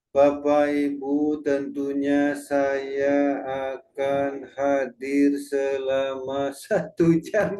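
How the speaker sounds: slow, dead-level tune with no rise or fall; monotone, every word on one pitch; flat monotone. Shown as a demonstration. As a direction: read it flat like a boring presenter. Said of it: "Nah, ini kan jadi datar ya".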